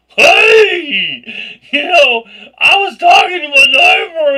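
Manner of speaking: high, loud flustered greeting; mock-cheerful nervous shout; loud embarrassed retelling. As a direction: replay the awkward moment, loud and high. Retelling her awkward talk to neighbor.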